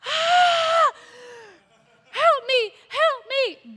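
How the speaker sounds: high, loud desperate cries for help; imitated screams. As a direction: scream like a woman in labor next door. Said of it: "Blood-curdling screams".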